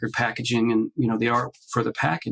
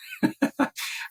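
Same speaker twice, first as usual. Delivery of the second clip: breathy laugh; 3 dB quieter than usual. Real, not acted. Nervous laugh recalling hesitation.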